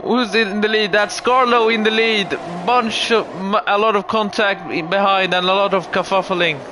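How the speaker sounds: lively play-by-play over the race; motorsport commentator. Acted.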